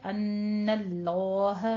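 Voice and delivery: अन्नल्लाह slowly recited with a held nasal ghunna; Quran recitation demo. Shown as a demonstration. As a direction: draw out the ghunna in अन्नल्लाह over two beats. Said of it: "दो हरकतों बराबर गुन्ना करके".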